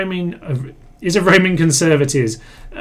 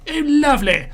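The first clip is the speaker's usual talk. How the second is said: pitched way up in a hammed-up posh gasp; mock posh high exclaim; 12 semitones higher than usual. Gamer greeting the war in a mock posh voice. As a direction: squeal it like a delighted aristocrat. Repeats the phrase in another voice.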